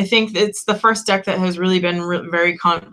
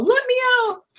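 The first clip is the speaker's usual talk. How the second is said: high squeaky pleading cat voice; voicing the cat. Owner voicing her cat, squeaky high plea. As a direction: give the cat a tiny high whine to be let out. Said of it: "my cat being all".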